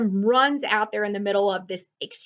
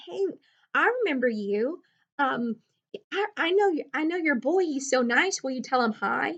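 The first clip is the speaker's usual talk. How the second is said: put-on chirpy little girl; 5 semitones higher than usual. Child Scout retold again, higher.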